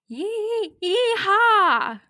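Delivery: high, halting attempt at a cowboy yell; unconvincing yeehaw. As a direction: stall on the first syllable, then squeal it out. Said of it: "I can't do it as convincingly".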